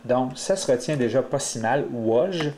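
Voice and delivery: normal talking voice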